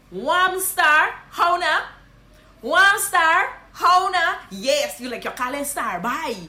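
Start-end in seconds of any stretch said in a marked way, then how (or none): 0.1-1.8 high, loud Guyanese Creole hail; Guyanese greeting. Shown as a demonstration. Guyanese teacher hailing a friend. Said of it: "This is what you're gonna say". 2.6-4.4 high, louder Guyanese Creole hail; Guyanese greeting. Shown as a demonstration. Guyanese teacher hailing a friend louder. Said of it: "This is what you're gonna say".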